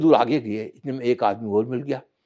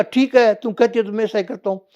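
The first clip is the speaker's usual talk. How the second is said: hearty agreement; 9 semitones higher, 7 dB louder than usual. Acted. Shiva agrees to Parvati.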